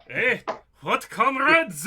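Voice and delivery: loud, high, drawn-out call; acted loud, slow shout over the shoulder; oblivious comrade. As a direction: call back cluelessly to your companions. GM voices oblivious character turning round.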